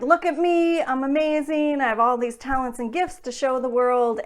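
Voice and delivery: thrilled little kid's boast, lightly acted; excited child. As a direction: show off to the world like a delighted child. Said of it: "when we're children, we're born and we're like".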